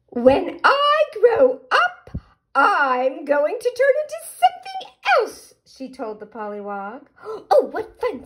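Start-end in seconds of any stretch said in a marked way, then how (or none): 0.1-5.5 caterpillar voice. Acted. Caterpillar tells pollywog her secret. 7.5-8.2 higher, eager little pollywog squeal; excited pollywog. Acted. Pollywog delighted by the news.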